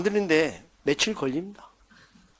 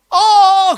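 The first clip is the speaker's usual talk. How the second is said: loud, high drawn-out "aah"; pained outcry; 13 semitones higher, 9 dB louder than usual. Acted. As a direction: cry out as if scrubbed raw. Acting bather scrubbed too hard.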